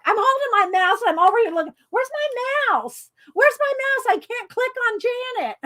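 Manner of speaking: high, fast flustered asking; frantic searching. Real, not acted. Hunting for her lost mouse, high and flustered.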